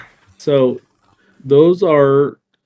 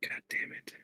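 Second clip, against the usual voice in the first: muttered under the breath; whispered curse; 20 dB quieter than usual. Real, not acted.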